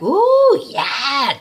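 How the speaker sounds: loud, high squealing ooh; teasing excitement. Retold or imitated. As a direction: tease her about the date. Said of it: "Shaliwa's like".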